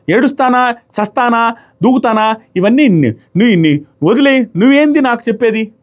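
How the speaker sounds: high-pitched, indignant mock retort; imitated rude tone. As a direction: mimic someone rudely brushing a friend off. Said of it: "ఆ rude tone Kalyan దగ్గర".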